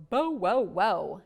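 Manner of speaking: slow loud barking bow wow wow; big dog bark. Acted. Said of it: "dogs go".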